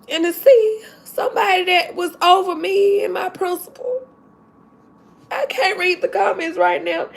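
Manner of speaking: tearful, voice breaking and high; fighting back happy tears; crying while talking. Real, not acted. Teacher tearfully telling viewers about meeting her old principal, crying. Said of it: "I'm so sorry to cry".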